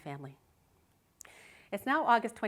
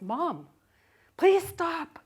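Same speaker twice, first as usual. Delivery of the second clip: put-on higher, louder scared kid's plea; pleading son; 10 semitones higher, 11 dB louder, much slower than usual. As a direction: beg like a frightened boy. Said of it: "He says".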